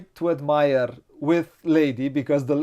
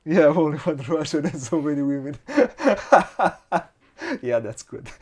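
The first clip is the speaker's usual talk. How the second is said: laughing; 5 dB louder than usual. Real, not acted. Joke about one Russian.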